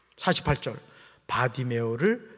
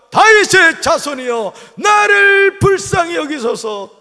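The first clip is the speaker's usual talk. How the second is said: loud, slow, high crying out for mercy; desperate shout; 10 semitones higher, 8 dB louder, slower than usual. Retold or imitated. Blind beggar shouting to Jesus, desperate loud cry. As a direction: cry out with everything, drawn out. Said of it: "그리고 더욱 외칩니다".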